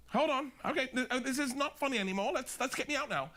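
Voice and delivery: high mock English accent, getting worried; bad English accent. Sinking man in England asking for rescue, high English accent. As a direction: imitate an English demonstrator losing his nerve. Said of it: "I'm bad with accents".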